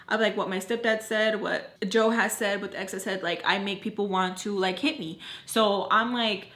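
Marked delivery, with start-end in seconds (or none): none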